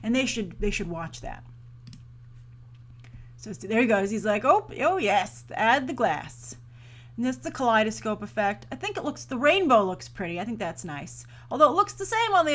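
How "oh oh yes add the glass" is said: slow mock director voice. Actor voiced directing the effects.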